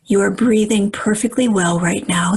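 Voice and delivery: normal talking voice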